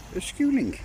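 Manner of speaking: squeaky high-pitched delight; high excited squeal. Real, not acted. Repeated, much higher second take.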